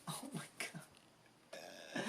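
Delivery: real hushed, breathy gasp of awe; slow, barely voiced, overwhelmed whisper; overcome with emotion. Real, not acted. Reader moved by the cards she is reading, overcome whisper. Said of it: "I'm getting all the feels".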